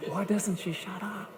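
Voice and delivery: a hint of a fed-up husband, muttered softly under the breath; a lightly voiced, quiet husband's complaint; grumbling husband. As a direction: sigh it out as a worn-out husband who wants quiet. Husband grumbling about talkative wife.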